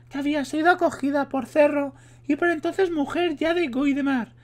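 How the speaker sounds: high put-on female voice; voz de mujer. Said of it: "estoy hasta los huevos de poner la voz de mujer". (hombre imitando voz de mujer)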